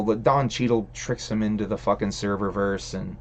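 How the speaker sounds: usual speaking voice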